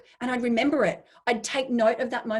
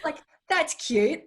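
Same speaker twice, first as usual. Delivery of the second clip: mock condescension; 7 semitones higher, 4 dB louder, slower than usual. Idea mocks people who claim credit.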